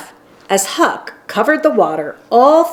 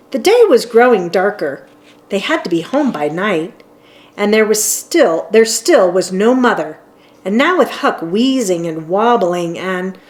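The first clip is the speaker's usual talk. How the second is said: plain reading voice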